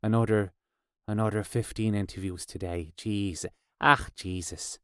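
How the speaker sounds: low, soft Irish grumble; Irish accent. Retold or imitated. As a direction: imitate a weary musician with an Irish accent. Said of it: "now I'm Irish, apparently".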